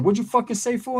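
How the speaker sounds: high, confrontational retort; attitude back. Retold or imitated. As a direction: throw the attitude right back at him. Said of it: "I gave him attitude back".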